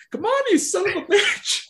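put-on high, raspy old man cursing; Herbert voice. Old patient straining at the urinal cursing himself, raspy high old man voice. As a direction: curse at it like a fed-up old codger.

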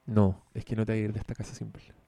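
ordinary talk, nothing marked

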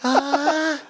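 very high, slow gushing voice; gushing doctor. Retold or imitated. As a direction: swoon over the results like a heart-eyes emoji. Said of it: "the little heart eyes emoji".

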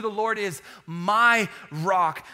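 "my" drawn out slowly before "rock"; slow line landing firmly on "my"; stress on MY. Shown as a demonstration. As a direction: hold and weight the word "my". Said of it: "the emphasis on the word "my".

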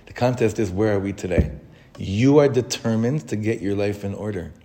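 normal talking voice

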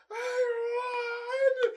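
very high, loud squeal; hammed-up winner's shriek, pitch shooting up; ecstatic mock cry. Reliving the surprise win in her category, ecstatic squeal.

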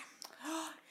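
quick, sharp intake of breath; excited gasp. Real, not acted. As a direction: gasp as if suddenly remembering the best part. Sudden excited reaction to dish.

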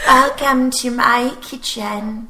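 put-on slow breathy indie girl voice. Shown as a demonstration. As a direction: drawl it like an indie pop singer. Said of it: "can you do The Voice".

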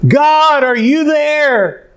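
high, long-held call to the heavens; acted yell to God. Hanging man calls up to God, high acted yell. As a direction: stretch it out in a high-pitched holler. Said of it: "he yelled".

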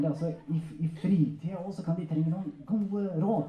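ordinary talk, nothing marked

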